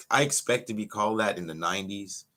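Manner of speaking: ordinary talk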